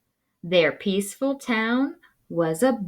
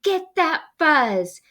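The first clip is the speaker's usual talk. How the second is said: rising to a high, louder shout; battle cry; 13 semitones higher, 4 dB louder than usual. Acted. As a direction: yell them into the fight.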